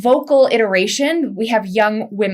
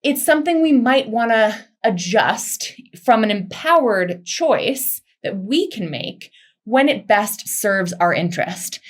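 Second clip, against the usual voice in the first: normal talking voice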